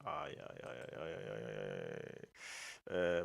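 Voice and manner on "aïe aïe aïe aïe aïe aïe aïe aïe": soft dismay. Real, not acted. Stream connection trouble.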